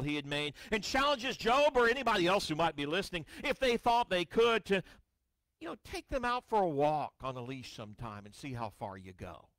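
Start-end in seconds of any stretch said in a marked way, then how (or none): none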